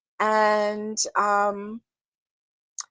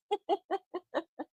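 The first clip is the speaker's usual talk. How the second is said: breathy giggle; 7 semitones higher, 11 dB quieter than usual. Real, not acted. Soft laugh.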